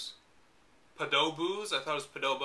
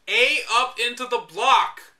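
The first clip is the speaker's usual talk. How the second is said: higher and slow, sarcastic; mocking reread; 5 semitones higher, 3 dB louder, slower than usual. Shown as a demonstration. Quoting the guide's awkward wording back, mocking. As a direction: drag out the clumsy phrasing to mock it. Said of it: "Jump onto which ledge".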